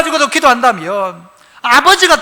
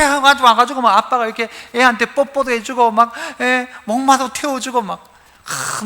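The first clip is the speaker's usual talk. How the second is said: ordinary delivery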